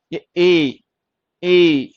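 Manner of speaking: teacher shows the long ए. Shown as a demonstration. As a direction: hold the ए long and clear. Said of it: "इसको हम पढ़ेंगे ए।".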